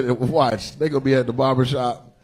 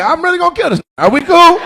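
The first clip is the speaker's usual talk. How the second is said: high, loud nervous shout; mock the man in the crowd, high and shouting; imitating audience member; 14 semitones higher, 6 dB louder than usual. Comedian imitating audience member, high nervous shout. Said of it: "He like".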